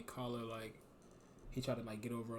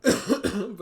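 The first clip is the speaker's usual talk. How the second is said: ordinary delivery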